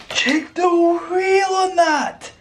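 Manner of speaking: high, slow squeal of amazement; real excitement. Real, not acted. Showing viewers the turbo's wheel, excited. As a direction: stretch it out high in delighted awe. Awed at turbo during unboxing.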